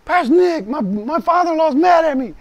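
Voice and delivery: hammed-up complaining caller; whiny complaining Marine. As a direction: whine like a sorry Marine calling the pastor. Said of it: "call me up and say".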